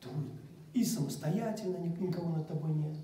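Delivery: ordinary talk, nothing marked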